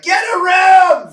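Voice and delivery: high, loud, drawn-out yell; heckling shout. Acted. Onlooker to a smooching cute couple, disgusted heckling shout. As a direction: holler it slowly at an embarrassing couple.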